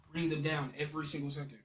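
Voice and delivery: ordinary talk, nothing marked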